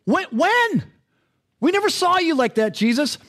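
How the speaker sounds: mock disbelief, as if caught off guard; surprised. The righteous protesting to the king, in bewildered surprise. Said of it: "They're like".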